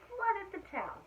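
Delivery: put-on lively read-aloud for children; animated story reading; storybook narration. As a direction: tell it like a bedtime picture book. Reads picture book aloud.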